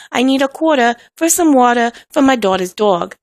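mock East Coast American accent. Shown as a demonstration. Mocking American listeners, put-on East Coast accent. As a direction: lay on the East Coast vowels. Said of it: "sound like I'm from the East Coast".

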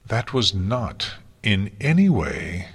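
strangled, slow, choked voice. Acted. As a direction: force it out strangled. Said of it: "her voice strangled".

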